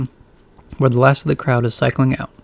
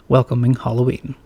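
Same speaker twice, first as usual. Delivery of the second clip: ordinary delivery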